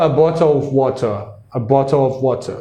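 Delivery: British accent, crisp t in bottle and water. Shown as a demonstration. English teacher demoing the British accent to the host. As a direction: say it like a Brit, water without the r. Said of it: "O britânico, ele vai falar".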